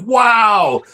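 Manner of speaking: mock amazement. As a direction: act out an impressed wow. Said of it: "everybody going like".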